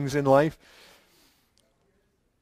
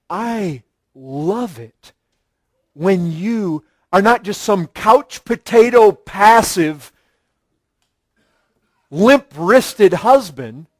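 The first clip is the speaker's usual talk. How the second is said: slow, sugary start turning into a louder dig; teasing insult dressed as praise; a touch of a sarcastic wife; mock sweet jab; 4 dB louder, slower than usual. Shown as a demonstration. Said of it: "doesn't say it just like that".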